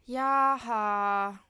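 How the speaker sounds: loud, groaning "ja, ha"; exasperated groan. Acted. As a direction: groan it out in annoyance. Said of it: "stöhnt Kim". (genervt laut stöhnen)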